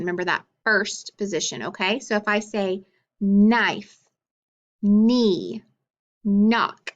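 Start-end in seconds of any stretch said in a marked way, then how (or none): 3.2-6.9 long held N opening "knife", "knee" and "knock"; "knife", "knee", "knock" each begun on a drawn-out hummed N; stressed initial sound. Shown as a demonstration. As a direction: stretch the first N of "knife", "knee", "knock", each word set apart. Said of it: "listen to the sound in the initial position".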